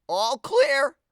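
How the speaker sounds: high call; cat captain calling the all-clear. A narrator's reading. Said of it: "the voice of Alfonso cried".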